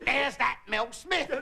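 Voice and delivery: a hint of a London cabbie recognising a star. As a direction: call out like a chirpy cabbie. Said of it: "turns to Griff and says".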